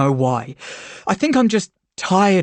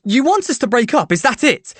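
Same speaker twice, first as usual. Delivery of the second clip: angry challenge; 6 semitones higher than usual. A narrator's reading. Narrator furious at Charlie.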